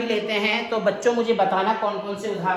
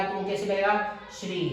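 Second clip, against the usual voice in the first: ordinary talk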